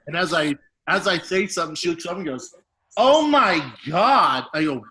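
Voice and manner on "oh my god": drawn-out, loud, higher "oh my God"; girl recoils, loud and slow, in shocked disgust; shocked gasp. Retold or imitated. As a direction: stretch it out in loud disbelief. Crush gasps at his booger.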